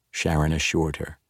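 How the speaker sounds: usual speaking voice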